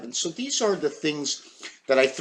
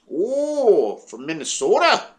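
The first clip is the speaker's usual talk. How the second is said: Minnesota accent; 6 semitones higher, slower than usual. Shown as a demonstration. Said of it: "I would have to add accents".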